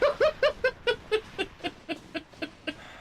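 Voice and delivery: high breathy nervous giggle; laughing at own bravado. Real, not acted. Laughs after claiming bravery.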